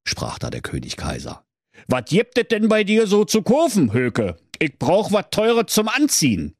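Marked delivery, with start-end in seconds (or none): 1.9-6.5 Kaiser's Berlin voice. Acted. Kaiser fragt Höke.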